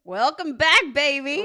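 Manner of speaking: loud, high, gleeful welcome; excited shout. Real, not acted. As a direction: cheer him back in. Gleeful welcome for favourite player.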